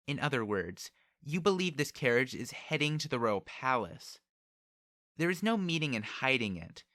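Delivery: put-on commanding young noblewoman; proud, haughty girl. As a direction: press him from a lofty height. Haughty girl presses Subaru.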